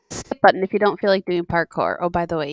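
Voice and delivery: ordinary talk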